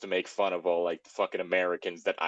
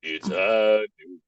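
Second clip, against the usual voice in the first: cowboy drawl line; 6 dB louder, much slower than usual. Pretend. Cowboy line, McCree reply.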